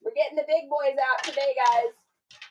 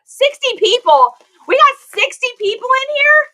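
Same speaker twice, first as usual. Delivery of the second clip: high, loud Boston accent-free delight at sixty viewers; surprised; 13 semitones higher, 10 dB louder than usual. Real, not acted. Vlogger to her live viewers, amazed and much higher, louder. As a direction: gasp out the number in disbelief.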